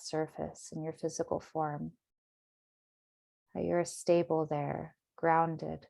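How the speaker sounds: calm, measured meditation voice with long pauses; guided meditation. Acted. Meditation teacher settling listeners into their bodies, guided meditation. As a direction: guide them gently inward, unhurried.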